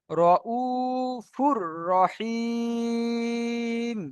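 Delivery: "রউফুর রহিম" slowly, with long held u and i; demonstrated recitation. Shown as a demonstration. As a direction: stretch the vowels on a level tone in "রউফুর রহিম". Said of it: "এই পড়াটি হলো".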